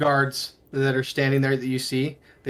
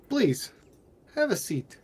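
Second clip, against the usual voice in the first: courteous, higher-pitched host voice; very polite; 8 semitones higher, slower than usual. Acted. Host seats his guest Irina, very polite. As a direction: politely offer a seat, pitched up. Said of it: "he's being very polite to Irina".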